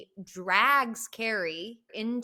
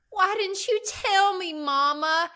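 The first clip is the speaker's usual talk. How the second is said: high, louder imitation of a frightened girl; pleading Carrie; 11 semitones higher, 7 dB louder than usual. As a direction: whine it up high like a terrified daughter. Carrie pleads with her mother.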